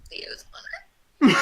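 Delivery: barely voiced, tearful echo; choked-up whisper. Real, not acted. Player echoing the boy's oath, tearful whisper. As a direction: whisper it through welling tears.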